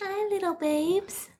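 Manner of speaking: whisper-coo to the duckling. Real, not acted. Greeting the duckling softly.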